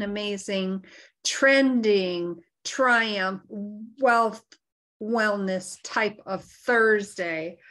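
slow, word by word with pauses; slow, careful wording. Real, not acted. Host signing off with a mouthful, carefully slowed. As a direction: pick through a tongue-twister slowly. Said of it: "say that a little slow there".

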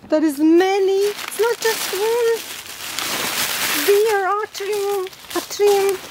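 high, excited amazement; real excitement. Real, not acted. Finding many pots instead of one, excited. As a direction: gush in happy disbelief. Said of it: "I'm so excited".

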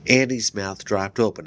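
plain reading voice

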